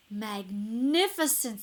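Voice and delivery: slow, drawn-out praise; mock admiration. A narrator's reading. Elizabeth flatters the dragon to tire him out, slow drawn-out praise. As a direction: lay the flattery on thick.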